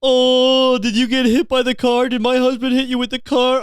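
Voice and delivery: high, loud fake-weepy taunt; mock them with put-on crying; exaggerated whiny mock sympathy. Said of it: "fake crying in the front of the person and just mock them".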